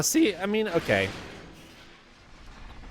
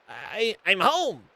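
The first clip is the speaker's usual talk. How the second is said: lightly voiced skeleton, slow; cheery skeleton; 7 semitones higher, slower than usual. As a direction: call it out slowly, homecoming. Skelly arrives home.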